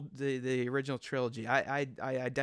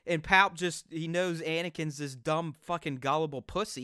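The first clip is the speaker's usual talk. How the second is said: ordinary talk, nothing marked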